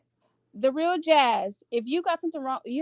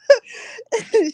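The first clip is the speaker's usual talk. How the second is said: high burst of laughter; amused laugh; 10 semitones higher, 6 dB louder than usual. Real, not acted. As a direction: break up laughing, high and loud. Laughs at the tweets gossip.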